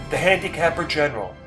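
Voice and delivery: ordinary delivery, nothing marked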